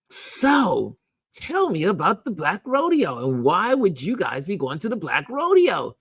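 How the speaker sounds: high-pitched, mock-innocent interrogation; playful probing. Client digging into the coach's background, playfully probing. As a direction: fish for the answer with exaggerated interest. Acting out her leading question.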